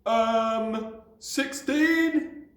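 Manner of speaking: put-on high, carefree child answer; foolish abandon. A narrator's reading. Small boy answering his sum, carefree. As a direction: blurt it cheerfully. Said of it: "answered with foolish abandon".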